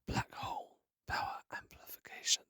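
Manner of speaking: faint, breathless whisper; dying whisper. Acted. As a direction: push the last words out in a whisper. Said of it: "he whispered".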